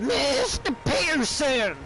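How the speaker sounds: high, drawn-out mock pubescent holler; Dutch hitting puberty. Streamer mocking a gang boss yelling orders, pubescent high holler. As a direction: call out like a boy whose voice is cracking, hammed-up. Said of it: "Dutch sounds like he's just hit puberty".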